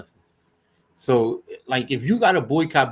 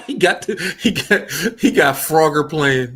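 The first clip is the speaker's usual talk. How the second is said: laughing; 2 semitones higher, 6 dB louder than usual. Real, not acted. Laughing at friend's old computer.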